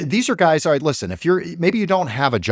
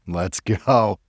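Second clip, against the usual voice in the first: ordinary delivery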